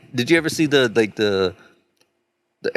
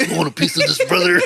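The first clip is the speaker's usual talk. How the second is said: high, hammed-up wrestler challenge; mock macho taunt, pitched up; Randy Savage; 7 semitones higher, faster than usual. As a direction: imitate a wrestler picking a fight. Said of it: "dresses up like Randy, Randy Savage".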